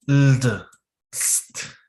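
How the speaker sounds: LD and ST sounded on their own; isolated consonant clusters. Shown as a demonstration. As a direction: say just the clusters "Ld", then "st". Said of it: "Se refiere a esto".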